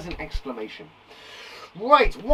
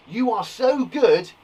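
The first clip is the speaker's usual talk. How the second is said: high, loud, slow praise; exclaiming; 5 semitones higher, 5 dB louder, slower than usual. Shown as a demonstration. Teacher exclaiming how good the footballer is, emphatic praise. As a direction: exclaim it with big admiration. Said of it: "it's exclaiming".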